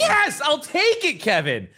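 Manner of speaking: high, drawn-out cheer of victory; triumphant. Real, not acted. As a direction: celebrate a score you'll gladly accept. Celebrating the score given for accent.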